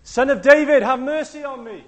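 high, loud cry for mercy; a blind beggar yelling to be heard; desperate shout. Retold or imitated. Blind beggar shouting at the passing crowd, loud and desperate. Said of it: "he shouts".

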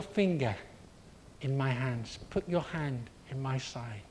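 a soft, gentle invitation; lightly voiced Jesus, calm and tender; softly calling Thomas close.

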